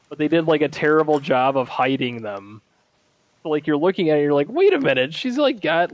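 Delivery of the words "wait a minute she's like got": high-pitched startled reaction; mock double take. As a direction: act out a viewer suddenly noticing something odd. Acting out a viewer's startled double take.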